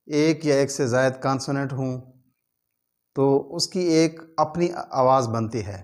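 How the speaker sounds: usual speaking voice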